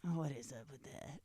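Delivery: low, soft mock musing; imitating co-host's delivery. Host mimicking how co-host says the show's name, low and soft. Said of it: "you say it like that".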